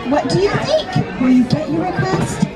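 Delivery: usual speaking voice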